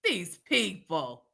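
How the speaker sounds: normal talking voice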